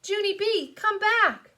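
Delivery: high, loud mother's call. Acted. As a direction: call after a runaway child. Mother calls after fleeing child.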